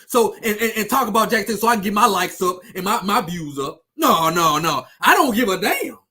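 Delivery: ordinary talk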